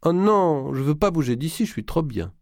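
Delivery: a contented, languid moan; happy moan. A narrator's reading. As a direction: moan it, lazy with pleasure. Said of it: "répondit dans un gémissement heureux".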